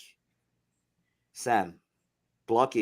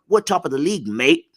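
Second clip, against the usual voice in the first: English accent; 4 semitones higher, 6 dB louder than usual. Shown as a demonstration. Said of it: "I'll do it in the English one".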